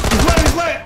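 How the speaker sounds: high, loud and hyped; excited callout. Real, not acted. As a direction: call it out fast and pumped.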